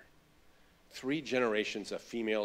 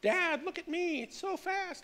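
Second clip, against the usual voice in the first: put-on much higher, slow, excited little girl calling to her dad; little daughter voice; slower than usual. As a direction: squeal it like a five-year-old on a scooter. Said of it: "And she goes".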